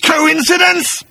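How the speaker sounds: high, loud, bitter rant; snarling accusation. Acted. Agrajag accusing Arthur of being there, high snarl. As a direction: shout the accusation, stretching the last word. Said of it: "he snarled".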